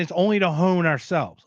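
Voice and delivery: ordinary talk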